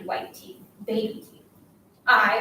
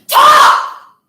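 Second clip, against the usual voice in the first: sudden high, piercing scream; 16 dB louder than usual. Acted. Girl demanding her silent classmate speak, screamed. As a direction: scream it as one explosive command. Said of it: "Then I screamed".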